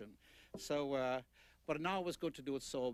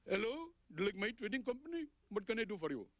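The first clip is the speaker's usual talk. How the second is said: much higher put-on voice of a clerk answering the phone; Indian phone clerk; 8 semitones higher, faster than usual. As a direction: play the trading company clerk picking up, pitched high.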